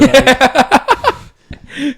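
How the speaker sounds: squealing laugh; high laughing squeal. Real, not acted.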